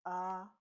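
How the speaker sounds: AH held high and level; first tone. Shown as a demonstration. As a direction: Mandarin first tone on AH, high, steady and loud. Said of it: "The first tone is this".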